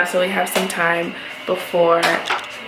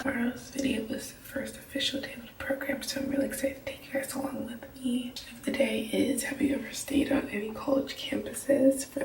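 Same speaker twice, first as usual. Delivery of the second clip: whispering so the roommates can sleep; hushed late-night whisper; quiet at night; 15 dB quieter than usual. Real, not acted. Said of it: "I'm whispering because my roommates are sleeping".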